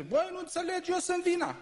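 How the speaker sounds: preacher mimics a husband yelling at his wife, high mock voice; mocking shouting husband. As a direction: squeal it like a pompous husband proving he's right.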